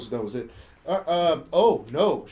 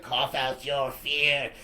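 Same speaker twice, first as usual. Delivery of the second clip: slow, lower mock-ritual command; mock witch incantation; 4 semitones lower, much slower than usual. Witches chant in film retelling.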